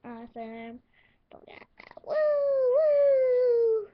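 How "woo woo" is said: high, loud woo of celebration; excited cheer. Acted. Celebrating HOH room.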